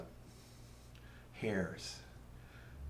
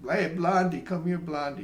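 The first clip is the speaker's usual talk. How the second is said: higher, louder call to a passerby; acted-out street call; 7 semitones higher, 5 dB louder than usual. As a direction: hail someone by their nickname. Calling someone by nickname.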